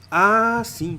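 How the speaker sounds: high, loud drawn-out "aah" of understanding; acted-out lightbulb moment; sudden realization. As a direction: let the penny drop, pitch leaping up. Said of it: "A professora vai e declama".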